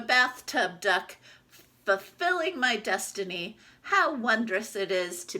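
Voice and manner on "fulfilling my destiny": ordinary delivery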